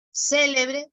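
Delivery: "célebre" hit on the first syllable; stress on CÉ of célebre. Shown as a demonstration. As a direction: lean on CÉ in "célebre". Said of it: "el acento cumple función distintiva". (acento en CÉ de «célebre»)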